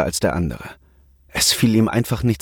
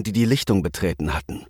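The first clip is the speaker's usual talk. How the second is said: plain reading voice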